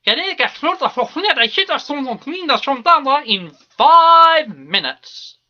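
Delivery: loud, drawn-out town-crier call; herald's bellow. Acted. Herald calls out to the hall after the song, bellowing. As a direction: bellow the announcement, stretching the words out.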